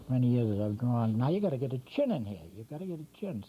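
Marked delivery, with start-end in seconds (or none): 1.2-2.4 brisk, fast run-through of the step; fast spoken drawing tip; quick instruction. Real, not acted. Drawing instructor stating next step. 2.6-3.4 much softer murmured "You gotta get a chin" to himself; quiet aside to self; soft repeat. Real, not acted. Instructor repeats the step to himself.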